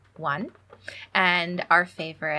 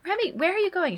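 calling after her; 13 semitones higher, faster than usual. Retold or imitated. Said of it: "And I was like".

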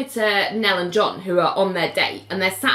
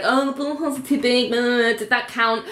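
higher-pitched, hammed-up whinge; mock complaining; 4 semitones higher than usual. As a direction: imitate a bowler grumbling excuses. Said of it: "and she's complaining".

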